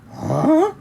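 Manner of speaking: a startled squeak; confused huh. Acted. Skipito puzzled by Don Diego's words.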